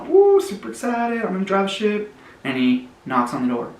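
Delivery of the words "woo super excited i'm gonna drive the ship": high, bouncy squeal of excitement; super excited. Acted. Donut bursting to drive the ship, high and thrilled. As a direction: gush like a kid about to steer.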